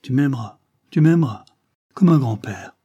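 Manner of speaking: moved wonder. Acted. Man hearing the child will love him, deeply moved. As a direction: repeat it with swelling emotion.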